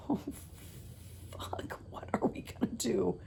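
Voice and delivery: hushed, panicked aside; panicked whisper. Retold or imitated. Whispering in panic to Vicky at lunch. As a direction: whisper it so mom won't hear.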